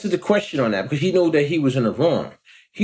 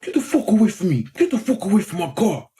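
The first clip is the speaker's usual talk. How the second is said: higher-pitched mock angry outburst; imitating animal outburst; 5 semitones higher than usual. Driver turns on him at the car, imitated angry outburst. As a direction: act out a man suddenly going wild. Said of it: "he turned into an animal".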